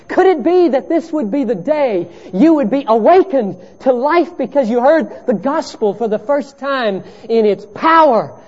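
high, loud, driving pulpit voice; impassioned sermon delivery; fervent preaching. Acted. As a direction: preach it out to the whole room. Preaching at full force.

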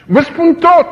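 high, loud desperate yell; tortured man yelling. Retold or imitated. Prisoner under torture giving in, desperate yell. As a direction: give in and scream it out. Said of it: "țipau unii".

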